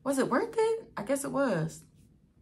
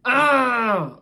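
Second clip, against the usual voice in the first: loud, high groan of exasperation; frustrated groan; 5 semitones higher, 11 dB louder than usual. Retold or imitated. Mother re-enacting fury over her car, loud frustrated groan. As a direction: let out a big angry "ugh". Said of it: "angry 'cause it's just like".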